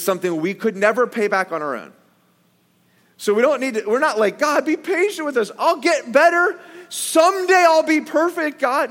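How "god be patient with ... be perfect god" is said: pitched-up mock grovelling; mock pleading sinner. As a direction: ham up a desperate plea to God.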